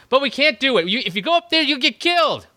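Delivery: overwrought TV drama voice; previously on recap. Pretend. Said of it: "let me do my impression of Walking Dead".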